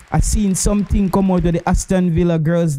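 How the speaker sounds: normal talking voice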